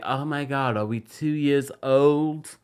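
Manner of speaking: slow, drawn-out mock accent for Meredith's retort; put-on accent. Meredith scoffing at the burn book, in a slow put-on accent. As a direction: imitate her with a vague put-on accent, stretching each word. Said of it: "I gave her an accent".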